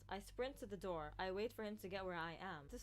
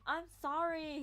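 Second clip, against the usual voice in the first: loud, higher-pitched yell; raised voice, calling out sorry; yelled apology; 8 semitones higher, 8 dB louder, slower than usual. Acted. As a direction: shout a frantic apology. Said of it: "he yells".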